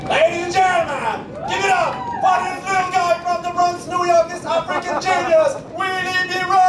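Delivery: high, slow booming MC announcement, far above own voice; hyped announcer intro. Acted. MC hyping the crowd for the next act, big announcer voice. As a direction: stretch it out like a boxing ring announcer.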